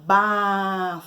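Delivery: "ba" held long on the vowel A; slow, sustained "baaa"; drawn-out syllable. Shown as a demonstration. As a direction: stretch the A in "ba" for learners. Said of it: "Ele tem o som da vogal".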